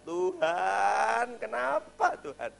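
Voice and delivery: high, loud, slow wailing plea to God; the grieving mother crying out to God, high, loud and slow; mother's anguish. Retold or imitated. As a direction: wail it up to heaven, drawn out. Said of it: "Pertanyaannya sederhana".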